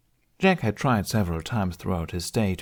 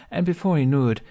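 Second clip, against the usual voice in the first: ordinary delivery, nothing marked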